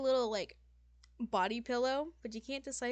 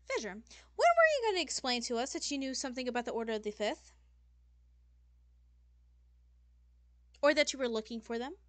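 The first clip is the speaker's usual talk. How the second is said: higher, louder, quick accusing question; demon lady demanding answers; 4 semitones higher, 8 dB louder, faster than usual. Acted. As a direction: confront them about what they hid. Demon lady confronts player.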